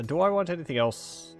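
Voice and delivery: ordinary delivery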